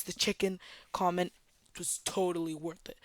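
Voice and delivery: normal talking voice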